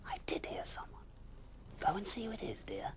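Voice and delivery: whispered high wife; Missus Bear. Acted. Bear wife sending her husband downstairs, whispered. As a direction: coax him, hushed and high.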